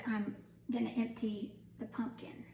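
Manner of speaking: hushed playful whisper. Acted. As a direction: whisper it like a secret. Playful aside while adding pumpkin.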